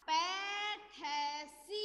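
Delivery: पैठसी stretched out very slowly; slow pronunciation demo. Shown as a demonstration. Teacher slowly sounds out पैठसी for pupils. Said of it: "तो ये हो जाएगा".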